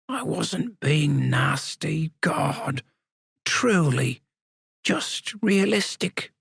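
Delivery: put-on high, slow old man voice; an old codger defending himself. A narrator's reading. Sam's inner voice, old man.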